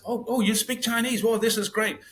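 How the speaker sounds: high-pitched, amazed mock reaction; pleasantly surprised. Taxi driver finding his foreign passenger speaks Chinese, pleasantly surprised.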